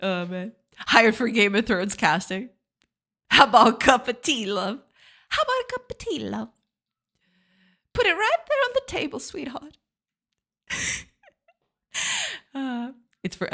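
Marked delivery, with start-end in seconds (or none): 3.3-4.8 full-voiced British tea offer; British accent. Acted British line, first take. 5.3-9.8 very high, soft British voice; dainty British lady. Acted. Same line in higher softer voice.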